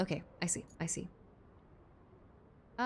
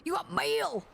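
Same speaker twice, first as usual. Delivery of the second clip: attempt at gruff; 7 semitones higher than usual. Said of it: "like, gruff?".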